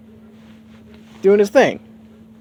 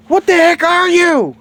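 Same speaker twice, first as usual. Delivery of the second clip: put-on much higher and louder girl voice; shrill startled girl; 8 dB louder than usual. Bulma popping out of the car, high and loud. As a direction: screech it at the stranger. Girl pops out, confronts him.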